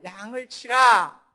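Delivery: loud, drawn-out imitation of a preacher; booming mock-sermon command; preacher's stock exhortation. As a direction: proclaim it slowly and forcefully, mimicking a pulpit command. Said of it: "천편일률적이죠".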